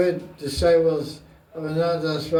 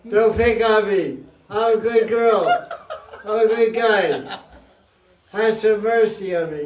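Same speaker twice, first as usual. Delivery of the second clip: mock pleading with God; 4 semitones higher than usual. Saint pleading with God to go easy, laughing whine. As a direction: beg Krishna to lay off, half joking. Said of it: "They speak like this".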